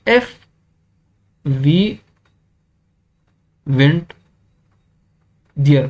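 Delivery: "If", "we", "went", "there" each set apart by long pauses; word by word dictation. Shown as a demonstration. Teacher dictating the clause to students, pausing after each word. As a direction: spell the clause out one word at a time. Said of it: "इस तरह बन जाएगा आपके पास".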